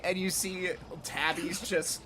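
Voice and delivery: normal talking voice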